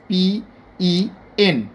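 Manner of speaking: "PEN" slowly as P, E, N; spelled letter by letter. Shown as a demonstration. Teacher counting the letters, "PEN" slowly spelled out. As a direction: spell out "PEN" with a gap after each letter. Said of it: "তিনটা letter লেগেছে".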